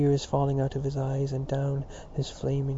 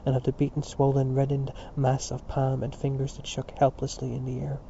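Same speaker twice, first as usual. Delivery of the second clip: ordinary delivery, nothing marked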